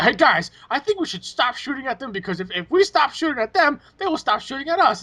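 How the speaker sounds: gentle mockery of a hopeful friend; Brandon. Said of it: "Brandon would try to be like".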